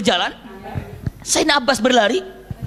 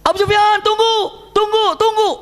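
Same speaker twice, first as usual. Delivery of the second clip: calling out; 11 semitones higher than usual. Acted. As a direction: shout after someone running away. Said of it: "Sayyidina Abbas berlari mengatakan".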